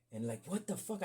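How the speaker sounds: ordinary talk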